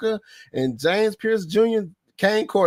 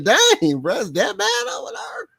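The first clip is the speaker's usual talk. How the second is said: mock surprise. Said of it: "I was like".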